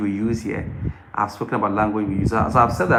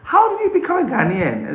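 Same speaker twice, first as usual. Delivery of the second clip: much higher, astonished questioning voice; incredulous official; 4 dB louder than usual. Retold or imitated. Embassy staff questioning a Nigerian with a Ghanaian passport, astonished. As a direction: play an amazed official who can't believe it. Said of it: "and they're like".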